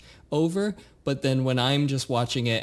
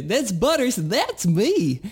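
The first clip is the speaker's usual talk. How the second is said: put-on high squeaky kid voice, much higher; Butters voice; 8 semitones higher, slower than usual. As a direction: chirp it like a cute cartoon boy. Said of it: "nasally and kind of annoying but cute little kid".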